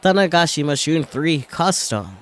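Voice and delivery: loud, high ring announcer call. Acted. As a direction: boom it out over the arena. Ring announcer introduces fighters.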